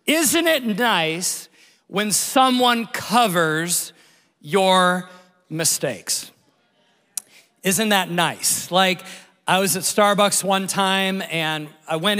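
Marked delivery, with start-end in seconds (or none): 0.1-8.7 very slow, drawn out with long pauses; slow, weighty musing. Real, not acted. Pastor to congregation, slowly savoring the idea. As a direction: let each word hang to make it land. Preacher lingering on a point.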